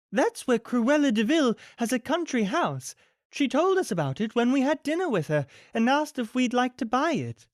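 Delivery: Missus Dearly voice. A narrator's reading. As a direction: recall it with sudden realization.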